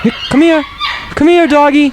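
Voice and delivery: high-pitched coo to a dog; calling a dog. Pretend. Host calls his corgis over, high doggy-calling voice. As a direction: sing out high for the dog to come.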